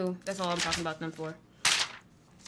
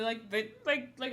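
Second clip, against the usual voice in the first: ordinary delivery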